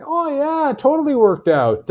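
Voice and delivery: high, bright sarcastic cheer; mock upbeat. Host mocking a wedding story that went bad, mock upbeat. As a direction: fake a happy ending. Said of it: "I was really scared for a second of".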